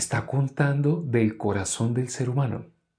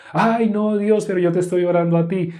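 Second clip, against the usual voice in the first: high-pitched mock pleading to God; protesting pray-er; 7 semitones higher than usual. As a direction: whine a mock excuse, voice lifted high. Voices a protesting hypocrite praying.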